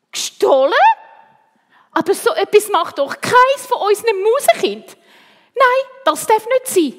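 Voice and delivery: high, loud indignant protest; shocked disbelief. A narrator's reading. Teacher hears mouse children are accused of stealing, shocked.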